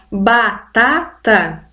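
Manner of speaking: batata in slow separate syllables, ba-ta-ta. Shown as a demonstration. Teacher sounding out a word for her students, slow syllables. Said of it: "pra cada parte da sílaba".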